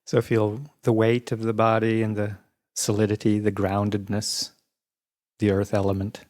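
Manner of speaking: even, unhurried meditation voice with long pauses; guided meditation. Real, not acted. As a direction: guide listeners calmly through the body, leaving space between phrases.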